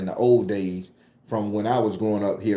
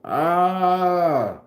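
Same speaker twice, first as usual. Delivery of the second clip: frustrated cry; 7 semitones higher, 3 dB louder than usual. Retold or imitated. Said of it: "And I'm like".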